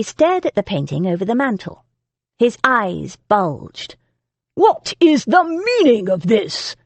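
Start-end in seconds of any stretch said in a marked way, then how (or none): 4.5-6.8 angry lord roaring, higher and slow. A narrator's reading. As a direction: bellow the question at her. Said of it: "he roared".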